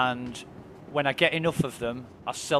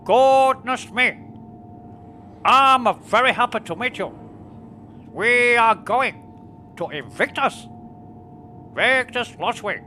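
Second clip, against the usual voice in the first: put-on much higher, louder, slow South African accent; a hammed-up South African elder greeting you with delight; Nelson Mandela impression; 10 semitones higher, 6 dB louder than usual. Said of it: "You know, like Nelson Mandela".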